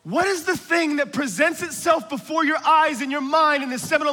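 rally the crowd with rising urgency; preaching. Acted. Preacher urges the congregation to be bold, preaching. Building sermon passage.

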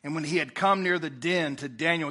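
ordinary delivery

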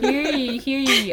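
loud, high proclaiming call; town crier. Acted. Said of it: "Announce it from the town criers".

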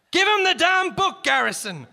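loud high commanding yell; shouting. Acted. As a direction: bark the order. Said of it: "shouted the commanding officer".

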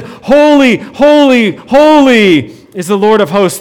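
proclaimed cry. Acted. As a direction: call it out like the angels' cry. Preacher proclaims the seraphim's cry.

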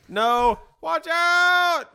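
high, loud panicked yell; warning yell. Retold or imitated. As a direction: scream the warning. Yelling a golf warning.

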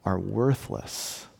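slow, soft and grave; solemn calm reply. Retold or imitated. Preacher voicing God answering his people gently. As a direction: let each phrase settle. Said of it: "and he says".

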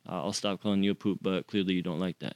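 low, soft, resigned mumble; retold meek giving-up; deflated surrender. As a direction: give in quietly, shoulders dropped. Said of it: "my shoulders dropped and I just".

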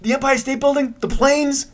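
high, disbelieving questions; can't believe she doesn't know; incredulous. Retold or imitated. A moviegoer telling his girlfriend how King Kong ends, incredulous.

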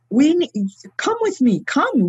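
high-pitched imitation of urgent pleading; retold tearful appeal. Repentant man calls others to join him, retold pleading. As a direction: urge them along, voice lifted high. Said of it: "And he's there crying".

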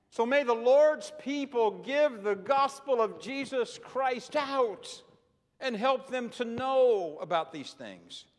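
loud, rising preacher cadence; full-force preaching. Acted. Preacher exhorting the church to spread the gospel, full force. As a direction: preach it out to the rafters.

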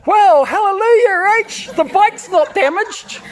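high, loud, hammed-up hallelujah; preacher mocking fake positivity; mock cheerful. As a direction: overdo the praise mockingly. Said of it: "going up to her and saying".

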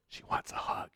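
whispered prompt over someone's shoulder; hushed aside. Retold or imitated. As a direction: imitate a discreet, very soft whisper. Retold aside over his shoulder.

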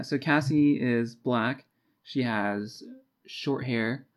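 normal talking voice

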